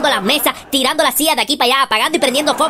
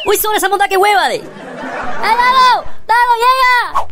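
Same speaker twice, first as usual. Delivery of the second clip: scared calling out; 8 semitones higher, much slower than usual. Acted. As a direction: yell for your friend, spooked. Calling out to friend in fright.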